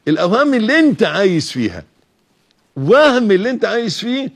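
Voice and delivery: loud, high rebuke from the pulpit; preaching at full force. Acted. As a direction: throw the word back at the doubter.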